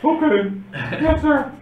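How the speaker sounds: high, loud barked military recruit reply; cadet voice. Acted. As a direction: snap it out like a nervous new recruit. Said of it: "That's my cadet voice".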